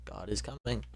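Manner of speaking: ordinary talk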